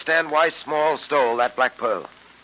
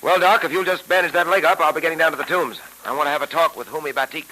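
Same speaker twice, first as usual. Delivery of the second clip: put-on old radio show. Old-time radio drama episode.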